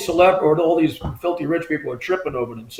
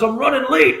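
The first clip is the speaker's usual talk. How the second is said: higher-pitched imitation of a hurried caller; retold excited friend; 8 semitones higher than usual. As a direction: mimic a buddy in a rush. Friend calls while rushing to lunch.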